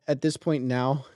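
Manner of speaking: ordinary talk, nothing marked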